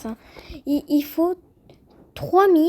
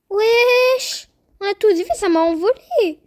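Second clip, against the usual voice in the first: high, loud startled outcry; loud astonishment; 7 semitones higher, 5 dB louder, slower than usual. Real, not acted. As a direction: shout it out, pitched way up in disbelief. Startled by being sent flying.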